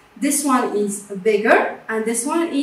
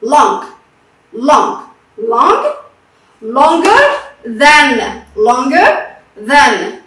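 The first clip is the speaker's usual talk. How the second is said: long and longer than said loud, slowly stretched; teacher drilling longer than, loud; 6 dB louder, much slower than usual. Shown as a demonstration. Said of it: "One sound".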